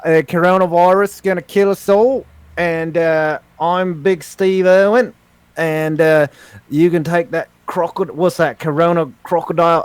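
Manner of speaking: broad put-on Australian accent; Steve Irwin. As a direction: play an Australian crocodile hunter.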